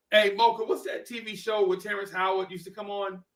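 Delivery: normal talking voice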